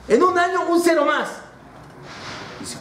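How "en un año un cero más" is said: much higher, emphatic, showman-like; grand promise. Acted. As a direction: sell the promise big. Friend makes a grand promise.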